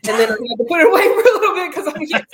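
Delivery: talking through laughter; laughing. Real, not acted. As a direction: laugh while saying it. Laughing while telling.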